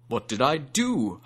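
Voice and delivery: slow, alarmed elephant; exclaiming. A narrator's reading. Hathi exclaiming in dismay to Kargash. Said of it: "Hathi exclaimed".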